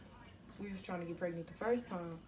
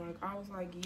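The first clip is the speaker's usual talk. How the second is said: usual speaking voice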